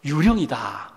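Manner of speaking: ordinary delivery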